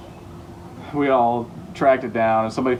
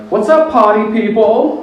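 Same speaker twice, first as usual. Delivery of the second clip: loud, much higher, slow goofy hello; silly high greeting; 11 semitones higher, 10 dB louder, slower than usual. Pretend. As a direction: greet the stalls like a cheery host. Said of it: "are you like".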